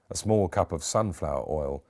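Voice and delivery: ordinary delivery, nothing marked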